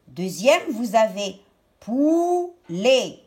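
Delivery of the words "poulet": "poulet" with a long "pou", then "let"; syllable-by-syllable "poulet"; split into syllables. Shown as a demonstration. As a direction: stretch "pou" and set "let" apart. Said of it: "prendre la première syllabe".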